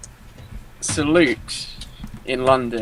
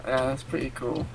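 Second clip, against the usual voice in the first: soft unvoiced aside; whisper; 3 semitones lower, 10 dB quieter than usual. Real, not acted. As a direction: whisper it under your breath.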